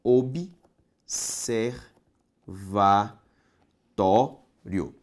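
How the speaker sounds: Observatório spelled out very slowly, syllable by syllable; teacher separating syllables aloud; syllables pulled apart. Shown as a demonstration. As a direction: stretch out each syllable of Observatório. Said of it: "Separe comigo".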